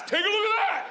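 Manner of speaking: very high, goofy mock-kid voice; high-pitched comic flaunting; brazen show-off child. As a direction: squeal it proudly, showing off. Acts out child showing off.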